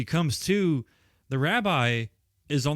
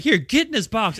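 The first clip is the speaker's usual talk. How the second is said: higher, louder bossy order; mock command; 8 semitones higher, 7 dB louder than usual. Fran ordering the recliner into the box, mock loud command. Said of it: "She's like".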